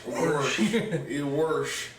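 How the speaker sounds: "wash" said as "warsh". Shown as a demonstration. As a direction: put a hard R into "wash". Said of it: "there's always an R in wash".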